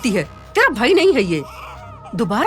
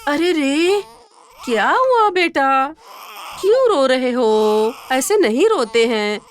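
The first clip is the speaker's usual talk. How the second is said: put-on slow, tender coo to a baby; slower than usual. Mother comforting her own crying baby, slow tender cooing.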